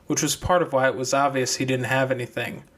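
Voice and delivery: plain reading voice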